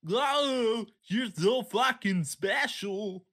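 mock voice. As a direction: drag it out like a cartoon bully.

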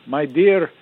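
put-on a light Russian accent, said warmly to a student. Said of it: "He said in his Russian accent".

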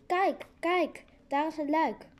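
calling out. A narrator's reading. Stranded passenger pointing out a hatch to everyone, calling out. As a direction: shout it down the train carriage. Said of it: "Ik roep door de tijn-, trein".

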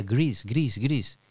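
Greece repeated as English "grees"; English pronunciation. Retold or imitated. Host recalling failed attempts to be understood, Greece in English pronunciation. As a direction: say Greece the English way, one try after another. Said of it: "me costaba mucho decir".

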